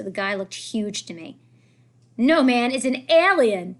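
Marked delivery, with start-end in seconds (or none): 2.2-3.8 loud, slow taunting shout; a kid yelling a mocking insult. A narrator's reading. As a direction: jeer it out at the new kid. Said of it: "said one of the other kids".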